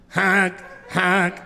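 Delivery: a flat, mock car horn honk, twice; imitated horn honk. Honking the truck horn with forehead.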